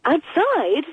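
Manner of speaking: a high, startled question; taken aback at the idea; surprised. Real, not acted. She balks when told the cat should sleep outside, surprised.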